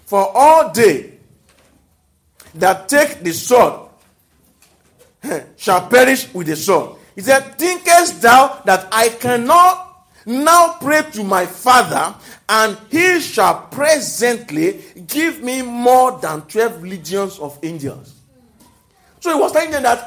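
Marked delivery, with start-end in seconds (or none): 0.0-7.0 slow, weighty Bible reading; scripture reading. Acted. Preacher reading scripture to the congregation, slow and solemn. 7.6-18.1 slow, weighty Bible reading; scripture reading. Acted. Preacher reading scripture to the congregation, slow and solemn.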